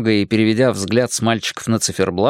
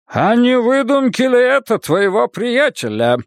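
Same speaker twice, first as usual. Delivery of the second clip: high-pitched, threatening interrogation; menacing; 12 semitones higher than usual. A narrator's reading. Librarian suspecting a friend's made-up tale, menacing. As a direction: loom over them with a threatening question. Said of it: "грозно спросил он".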